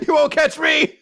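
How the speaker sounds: high taunt through laughter; laughing taunt. Pretend. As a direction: giggle it while running off. Figure fleeing, laughing.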